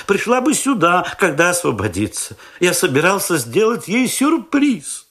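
high, eager proud-father voice; fussy young father. A narrator's reading. Young father boasts to narrator about his baby, high and eager.

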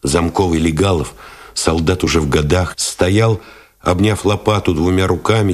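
plain reading voice

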